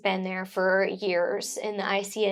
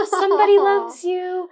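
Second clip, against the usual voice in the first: high, sweet, louder; cute sweet voice; 10 semitones higher, 6 dB louder than usual. Retold or imitated. Mother quoting the nurses' keepsake card, high cute voice. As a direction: read it like a loving note to a baby. Reading the craft paper's message.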